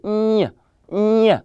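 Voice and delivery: NY as one nasal French "gn" sound; isolated nasal "Ny", repeated after a pause; nasalized sound. Shown as a demonstration. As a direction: say "Ny" through the nose, like "nyuh". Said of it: "It's nasalized sound".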